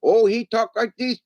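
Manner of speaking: high put-on voice; mimicking family member. Showing how one family member talks, high mimicry. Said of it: "that's exactly how he sounds".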